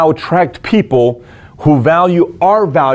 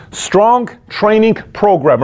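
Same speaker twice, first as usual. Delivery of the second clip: slow and high, every word leaned on; drawn-out heading; 6 semitones higher, much slower than usual. Real, not acted. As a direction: announce it like a title card. Announcing next point, drawn out.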